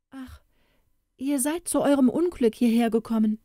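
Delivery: lightly played storybook woman warning a stranger; worried young woman. A narrator's reading. Startled woman warns the youth.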